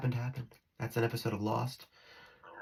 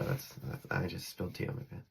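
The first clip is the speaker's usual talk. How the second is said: muttered aside; 6 semitones lower, 10 dB quieter than usual. Real, not acted. Spilled tea, aside mutter.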